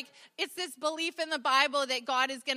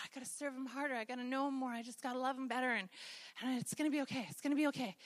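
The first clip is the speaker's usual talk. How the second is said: much softer inner promise to try harder; quiet self-talk; 3 semitones lower, 9 dB quieter, faster than usual. Retold or imitated. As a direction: tell yourself quietly it'll be fine.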